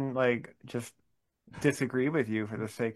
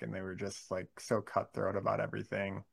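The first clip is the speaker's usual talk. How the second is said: whispered aside; 8 dB quieter, faster than usual. Real, not acted. Trailing critique of Walmart.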